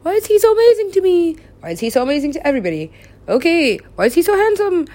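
high, loud mock-anguished questioning; exasperated wailing. Acting out sitting alone asking why, loud wailing. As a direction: wail it up high and loud, pleading for answers. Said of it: "I sit here like".

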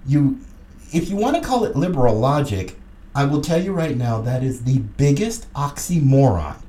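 ordinary talk

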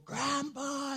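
put-on high little boy's call, Grandpa; child calling. Grandson calling from the slide, high child voice. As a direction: sing it out like a small grandson.

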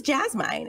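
"Jasmine" ending in a long "mine"; wrong long-i ending on "Jasmine"; mispronounced Jasmine. Retold or imitated. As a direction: insist on "Jasmine" as jazz-MINE. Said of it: "it's down incorrectly".

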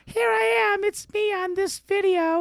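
very high, squeaky mock kid; high recorded kid voice. Host mimics his childhood voice on tape, squeaky mockery. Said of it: "then you listen to yourself".

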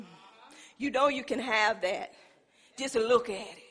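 low, soft, sly whisper of the tempter; tempting devil voice. Retold or imitated. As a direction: lure him in like the enemy. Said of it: "the enemy ... saying".

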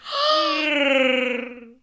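loud, high Wookiee growl; Wookiee roar. Pretend. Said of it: "my Wookiee noise".

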